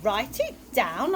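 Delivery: put-on child's questioning echo with a big rise on "down"; puzzled question. A narrator's reading. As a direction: echo "Write it down" as a question, rising sharply on "down". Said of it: "asked Chloe".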